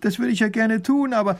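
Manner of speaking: high-pitched pleading, cut off; desperate protest. Acted. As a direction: protest helplessly. Desperate widow pleads, gets cut off. (verzweifelt einwendend, mit hoher Stimme)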